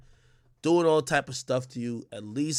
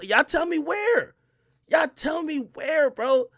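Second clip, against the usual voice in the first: challenging; 11 semitones higher, slower than usual. Real, not acted. Challenging the chat to name a better country.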